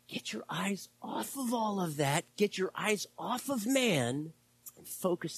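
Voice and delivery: hushed then pressing appeal; urgent exhortation. Real, not acted. Pastor pleading with the congregation, intense. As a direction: drive it home to the church.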